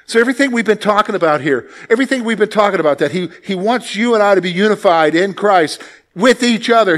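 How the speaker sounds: ordinary delivery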